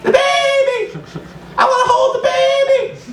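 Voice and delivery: very high, squeaky falsetto; baby-talk voice. Acted. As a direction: gush over the baby in falsetto. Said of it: "that's the regular baby voice".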